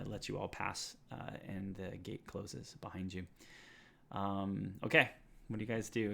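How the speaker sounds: ordinary talk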